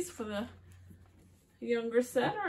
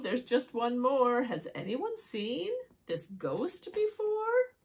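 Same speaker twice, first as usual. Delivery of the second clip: lightly voiced toad, rising question; a hint of an intrigued toad; curious toad; 4 semitones higher, 6 dB louder, slower than usual. Toad asks friends about the ghost, mildly excited. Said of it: "says Toad".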